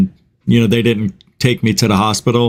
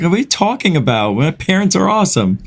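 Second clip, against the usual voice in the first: higher, quick mock disbelief; retold incredulous protest; 6 semitones higher, faster than usual. Teenager defending his parents to girlfriend's mom, imitated incredulous protest. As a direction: protest fast and higher, as if baffled.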